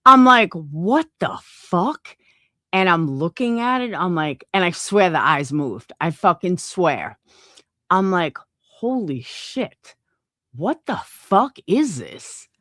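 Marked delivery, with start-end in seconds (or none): 0.5-2.2 very slow, drawn-out mock bewilderment; retold disbelief. Retelling reaction to glowing mask. 8.6-12.5 slow, drawn-out stunned reaction; retold shock. Retelling shock at the mask.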